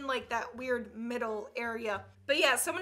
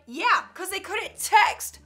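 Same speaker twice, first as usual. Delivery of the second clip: put-on louder, higher, drawn-out kid's retort; snappy comeback; 6 semitones higher, 9 dB louder, slower than usual. Kid claps back at the parent, snappy and loud. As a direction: fire back a sassy kid comeback, stretched out.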